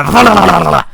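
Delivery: mock worrying growl. Acting out the drop bear chewing his shoe, a mock dog growl. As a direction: shake the shoe in your teeth and grumble. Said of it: "how a dog ... gets a bone, they go".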